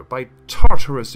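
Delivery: sudden very loud outburst; loud oath. Acted. Wife swearing loudly to the crowd. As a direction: burst out with it.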